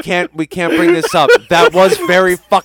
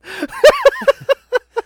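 high laugh. Real, not acted. Laughing at grade joke.

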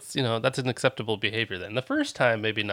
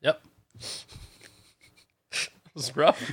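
ordinary delivery

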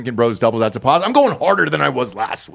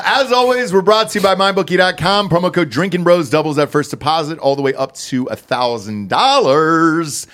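bright higher sponsor plug; upbeat ad read; 4 semitones higher than usual. Acted. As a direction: sell it like a radio spot.

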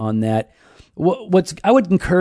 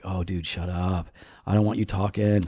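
weary muttered aside; 6 semitones lower, 10 dB quieter than usual. Retold or imitated. Baptist viewer reacting to a TV spokesman, quiet weary grumble.

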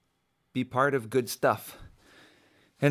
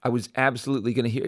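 ordinary delivery

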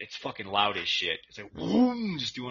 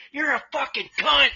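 higher, louder furious yell; retold yell; 13 semitones higher, 5 dB louder than usual. A kid screaming an insult at the bus driver, higher and louder. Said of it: "Like that".

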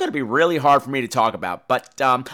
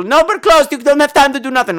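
high, loud, brusque refusal; caricatured high-pitched shout; mock gym owner; 9 semitones higher, 5 dB louder than usual. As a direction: imitate her turning a customer away, high and loud. Imitates gym owner turning him away.

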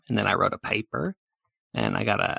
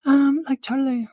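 ditzy bystander; 14 semitones higher, 6 dB louder than usual. Acted. Bystander answering a warning to step back, high ditzy voice. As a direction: pipe it up high and airheaded.

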